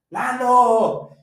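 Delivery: loud call of a name; shout. Retold or imitated. Friend shouting for Lalo across the woods, loud call. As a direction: call out into the dark. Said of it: "y le gritó".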